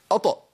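"أط" with the ط released into an added vowel; overdone qalqala. Shown as a demonstration. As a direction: overdo the bounce after "أط". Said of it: "لا ده ولا ده". ("أط" بقلقلة مبالغ فيها)

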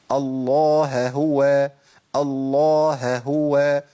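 الله هو with the end of الله and the ه of هو both clearly heard; both h sounds kept. Shown as a demonstration. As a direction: dwell on the two ه sounds. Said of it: "يبقى حق الهائين". (الهاءان واضحتان في الله هو)

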